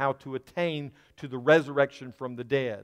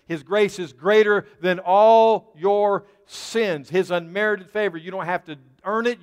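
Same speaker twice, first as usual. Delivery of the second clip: higher, louder, fervent sermon voice; full-force preaching; 5 semitones higher, 6 dB louder than usual. Real, not acted. Pastor stirring the congregation about God's grace, fervent preaching. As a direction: preach it home with rising fire.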